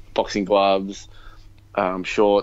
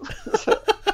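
high giggle. Real, not acted. Giggling at his own rank.

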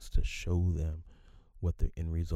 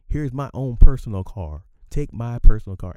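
loud, higher imitation of the boss; mock boss; 5 semitones higher, 13 dB louder than usual. Boss handing his personal truck to a worker, loud mock insistence.

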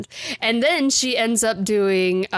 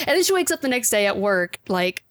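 ordinary talk

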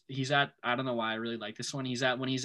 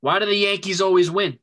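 loud, higher mock boast; loud quote; 8 semitones higher, 11 dB louder than usual. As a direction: belt the line out high and loud. Quoting the film dramatically.

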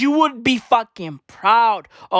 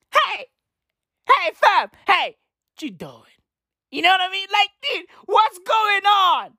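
mock calling out; 10 semitones higher than usual. Calling out to protester.

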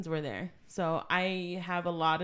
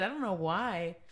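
ordinary talk

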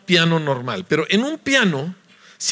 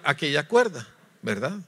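ordinary talk, nothing marked

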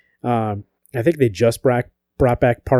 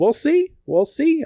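much higher, teasing sing-song; coy sing-song; slower than usual. Real, not acted. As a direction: dangle it, playfully noncommittal. Coy dodge about Fast Pass.

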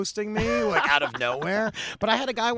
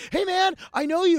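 excited fan; 13 semitones higher, 5 dB louder than usual. Retold or imitated. Fan greeting him on acid.

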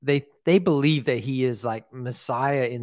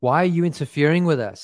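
mild mock scream; 5 semitones higher, 5 dB louder, faster than usual. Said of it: "They began screaming at him".

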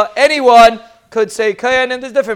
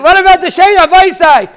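higher and louder, a sudden cry to the group; excited call-out; 7 semitones higher, 5 dB louder than usual. Real, not acted. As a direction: throw the question out loudly, pitched up. Calls out a question to listeners.

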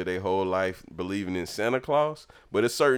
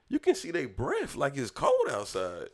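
put-on amazed child; 6 semitones higher than usual. Kid marveling to mom at the game's graphics, amazed. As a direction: re-enact a kid's awe in a high voice.

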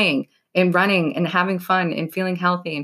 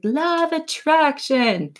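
higher, slow mock-mystic "Law of attraction."; drawn-out higher sarcastic imitation; mocking everyone; 9 semitones higher, slower than usual. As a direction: parody the buzzword everyone repeats. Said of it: "everyone's like".

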